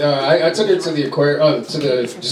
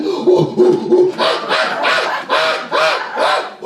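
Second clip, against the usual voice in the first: monkey noises; 7 semitones higher, slower than usual. Retold or imitated. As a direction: hoot like a kid playing monkey. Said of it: "She's like".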